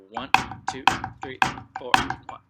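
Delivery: counting beats out loud over the drums. Real, not acted. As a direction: call each beat over the playing.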